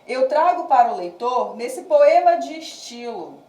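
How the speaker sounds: projected, rhythmic verse reading; cordel recitation. Acted. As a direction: recite the cordel with stretched line endings.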